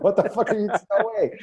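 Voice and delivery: high, laughing disbelief. Retold or imitated. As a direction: scoff through a laugh. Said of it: "I'm like".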